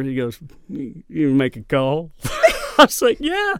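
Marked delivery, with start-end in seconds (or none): 0.7-2.1 slow Texan drawl, lightly done; Boomhauer drawl. Retold or imitated. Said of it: "it's almost Boomhauer". 2.2-3.5 high giggle through the words; laughing. Real, not acted. Laughing at own story.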